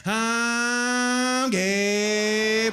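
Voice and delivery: loud high car whine saying it; Prius engine voice. Retold or imitated. Comic voicing the Prius as it speeds away, loud mock car voice. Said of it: "Light turned green".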